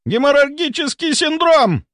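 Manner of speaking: very high, shrieked diagnosis; panicked screech. A narrator's reading. Volkonsky sees the bleeding spread, panicked screech. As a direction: let the voice shoot up into a squeal. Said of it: "Голос Волконского поднялся до визга".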